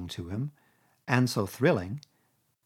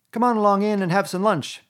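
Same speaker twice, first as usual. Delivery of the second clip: higher, louder, smooth invitation; smooth things over; diplomatically; 9 semitones higher, 7 dB louder than usual. A narrator's reading. Toad soothing Rat with a lunch offer, diplomatic. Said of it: "he said diplomatically".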